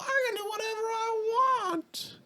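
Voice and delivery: very high, slow, drawn-out retort; mock defiance. Random lady defying the dad, mock defiant. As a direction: imitate a defiant arguer in a squeaky-high voice. Acted argument over a hat.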